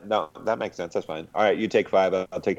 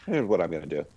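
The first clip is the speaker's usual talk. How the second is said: usual speaking voice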